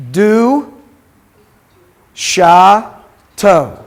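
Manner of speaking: "du, sha, to" with long gaps between syllables. Shown as a demonstration. Teacher modelling a reading for students, "du, sha, to" syllable by syllable. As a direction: sound out "du", "sha", "to" separately, each drawn out. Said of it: "So you pronounce that".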